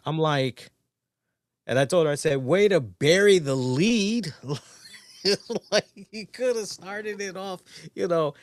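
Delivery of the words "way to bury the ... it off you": dry tease. Retold or imitated. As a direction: needle her about the late reveal. Teasing wife after the reveal.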